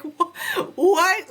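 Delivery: can't believe her ears; stunned what. Retold or imitated. Hearing the midwife say she may decline anything, high and stunned.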